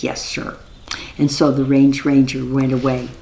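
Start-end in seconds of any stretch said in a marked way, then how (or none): 0.0-0.6 soft whispered yes, sir; whispered reply. Acted. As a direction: breathe it quietly. Invisible Tajar answers softly.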